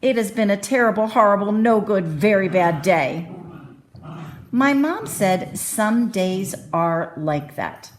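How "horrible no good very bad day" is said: ordinary delivery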